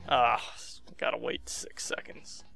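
ordinary talk, nothing marked

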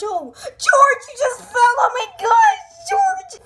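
high shrieking panic; shocked scream. Acted. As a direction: scream when he falls. Boyfriend fell down.

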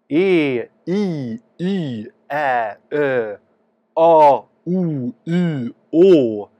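each vowel held out long and clear for the scope; E, I, Y, Ä, Ö, A, O, U, Å sung out one at a time; sustained vowel demo. Shown as a demonstration. Doctor shows vowels through a camera, holding each vowel. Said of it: "när jag tar olika vokaler".

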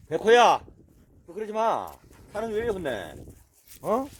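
loud, high telling-off of a dog; scolding call. Real, not acted. Owner scolding the dog that bullies another, loud and high.

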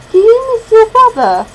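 put-on high, loud little-boy question; little boy's voice. A narrator's reading. Boy asks a girl about her brother, little boy's voice. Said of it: "he asks".

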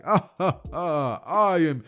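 high, loud staccato hero laugh into words; booming hero entrance. Retold or imitated. All Might barging in on Deku, booming hero entrance. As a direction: burst in with big "ha"s.

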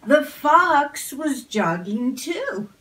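usual speaking voice

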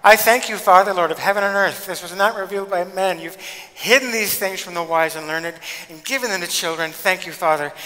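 loud, high, bursting with joy; joyful prayer to the sky. Retold or imitated. Preacher voicing Jesus praying, loud and joyful. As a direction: throw the head back and thank the Father loudly, overjoyed. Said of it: "tilts his head back and looks to the sky".

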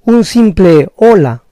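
normal talking voice

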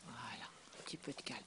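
hushed whisper for calm. Real, not acted. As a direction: breathe it out soft and low.

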